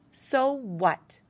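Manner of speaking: loud, sharp retort; loud angry snap; snapped. Acted. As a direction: snap it back at her. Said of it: "I snapped".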